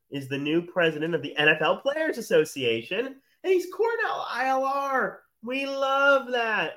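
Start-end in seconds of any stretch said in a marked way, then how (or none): 3.4-6.7 proud cheer. Real, not acted.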